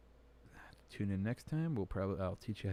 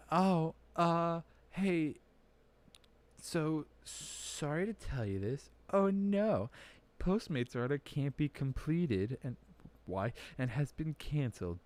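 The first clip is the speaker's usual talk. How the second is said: sugary fake-apology voice, raised pitch; mock customer service voice; 5 semitones higher, slower than usual. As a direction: parody a chipper app message. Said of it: "it's like".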